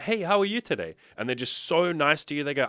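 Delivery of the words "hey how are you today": much higher, sugary greeting with a big smile; overly sweet customer. Retold or imitated. Overly nice customer greeting a worker, much higher sugary voice. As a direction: gush it like the nicest customer ever. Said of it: "They come in with a big smile".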